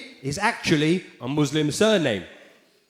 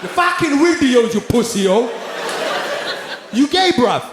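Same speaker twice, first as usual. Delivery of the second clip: loud, higher roadman insult; angry roadman; 8 semitones higher, 5 dB louder than usual. Retold or imitated. Angry lad cussing the comedian, loud roadman. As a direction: spit the insult like a street lad. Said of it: "in my what?".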